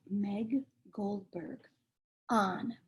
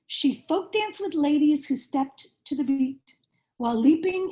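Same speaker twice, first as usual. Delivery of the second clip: lively picture-book read-aloud, rhyming bounce; reading to children; 3 semitones higher, 6 dB louder than usual. A narrator's reading. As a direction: read it like bedtime story time for little kids.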